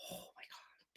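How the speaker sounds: awed whisper; stunned whisper. Retold or imitated. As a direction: breathe it out in disbelief. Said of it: "I'm just going".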